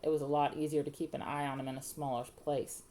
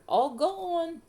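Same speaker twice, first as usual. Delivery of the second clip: put-on high, loud little-boy wail; plaintive toddler; 12 semitones higher, 7 dB louder than usual. A narrator's reading. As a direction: wail the question like a hurt small child. Hurt toddler asking about teeth.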